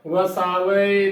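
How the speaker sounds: ਵਸਾਵੇ as "va-saa-va-e"; correct four-syllable reading. Shown as a demonstration. Teacher giving the correct reading, ਵਸਾਵੇ as "va-saa-va-e". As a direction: sound a separate final e in ਵਸਾਵੇ. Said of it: "ਦੀ ਜਗ੍ਹਾ".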